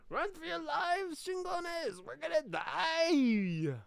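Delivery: put-on screaming, high, slow panicked Mexican accent. Fleeing Aztecs screaming to each other, Mexican accent panic. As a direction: shriek the warning in a Mexican accent. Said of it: "doing my best to do a Mexican accent".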